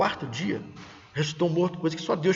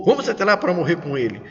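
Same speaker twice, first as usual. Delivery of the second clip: quicker, more forceful call to go; rallying intonation; 4 dB louder, faster than usual. Shown as a demonstration. Disciple Tomé urging fellow disciples to go with Jesus, rallying. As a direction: urge the others along briskly. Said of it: "não temos a entonação do texto".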